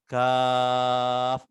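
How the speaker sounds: Kaf drawn out, sung for six beats; held long Kaf. Shown as a demonstration. Teacher modelling a long Kaf. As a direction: chant Kaf long and steady. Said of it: "kalau kita tulis di eja".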